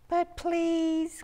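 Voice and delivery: high-pitched, pleading cry of a worrywart; worried cry. A narrator's reading. Town worrywarts cry a warning to friends, worried high cry. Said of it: "cried Midge and Pidge".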